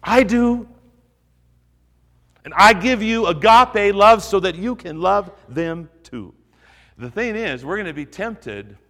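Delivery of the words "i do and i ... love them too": raised, louder voice of God answering; a booming hint of God's own voice; God speaking. Preacher voicing God to the congregation, louder and higher. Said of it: "God says".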